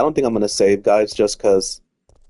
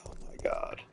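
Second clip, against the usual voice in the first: whispered oh my god at your own blunder; exasperated whisper; 18 dB quieter than usual. Real, not acted. Player realizing his mistake, whispering in dismay. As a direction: breathe it out under your breath in disbelief.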